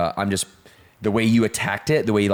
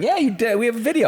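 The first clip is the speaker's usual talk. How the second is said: teasing; 14 semitones higher, faster than usual. Real, not acted. Teasing rebuttal.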